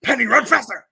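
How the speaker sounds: loud, high cheer at a dog; blasting. Acted. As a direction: belt it out urging the dog on. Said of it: "start blasting".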